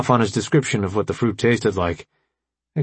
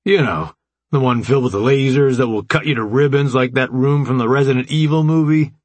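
higher, sarcastic ribbing; heavy sarcasm; 4 semitones higher, 4 dB louder than usual. A narrator's reading. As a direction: spell out the danger mockingly, pitch climbing. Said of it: "the sarcasm heavy".

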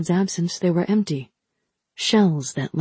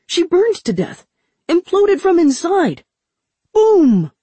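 much higher, louder, dramatic; excited; 11 semitones higher, 6 dB louder, slower than usual. Acted. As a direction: build to a gleeful boom. Ramsa dramatizes the death.

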